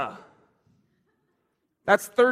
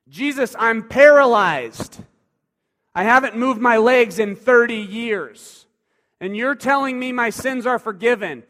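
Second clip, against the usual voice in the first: louder and higher, pleading in outrage; paralyzed man protesting to Jesus, louder and higher; indignant protest; 4 semitones higher, 7 dB louder than usual. Acted.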